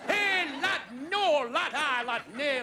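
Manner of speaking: blustering Yorkshire father scolding his son; gruff Northern dad. Retold or imitated. Northern father scolding his son about show business, blustering Yorkshire voice. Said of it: "dad said".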